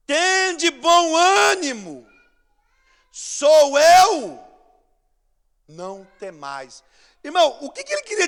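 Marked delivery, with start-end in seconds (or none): 0.1-6.8 Jesus proclaiming. Pretend.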